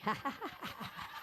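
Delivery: soft breathy run of ha-ha laughs; exercise laughter. Shown as a demonstration. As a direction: start a deliberate laugh, mostly breath. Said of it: "laugh for one minute".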